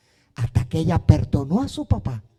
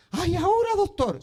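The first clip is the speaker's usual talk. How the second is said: hesitant woman; 10 semitones higher than usual. Retold or imitated. Sister hesitates, voiced by him.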